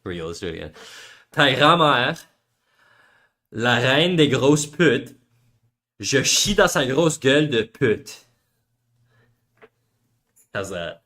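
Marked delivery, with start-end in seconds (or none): 1.3-8.2 slow, deliberate French insult read; a touch of French Canadian accent, slow; mock French accent. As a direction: lay on the Québécois accent, drawn out. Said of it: "The accent makes it so much better".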